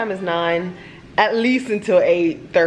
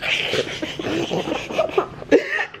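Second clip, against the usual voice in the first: high squealing giggle; giggling helplessly while tickled; being tickled; 10 semitones higher, 5 dB quieter than usual. Real, not acted. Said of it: "Don't tickle me, silly".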